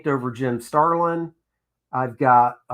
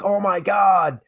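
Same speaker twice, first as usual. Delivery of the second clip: a sudden higher, delighted outburst; excited; 6 semitones higher, slower than usual. Retold or imitated. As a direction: burst out with excitement at hearing the name. Excited about working with Jim.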